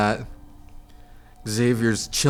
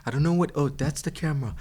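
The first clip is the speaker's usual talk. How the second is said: usual speaking voice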